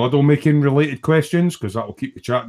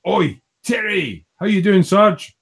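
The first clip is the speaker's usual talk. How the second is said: higher-pitched hail, first words drawn out; mock call-out; 7 semitones higher than usual. Host voices Sergeant Bones's chat greeting, mock call-out. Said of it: "and he's going".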